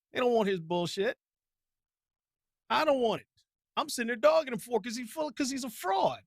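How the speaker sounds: high, fired-up ranting, fast in places; real worked-up rant; heated rant. Real, not acted. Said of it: "I'm done ranting".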